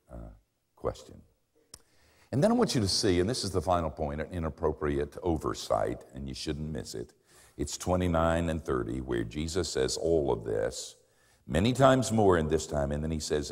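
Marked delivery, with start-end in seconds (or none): none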